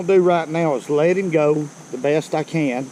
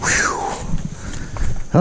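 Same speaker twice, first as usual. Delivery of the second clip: exhausted whew; 3 dB quieter than usual. Real, not acted. After climbing the steep bank.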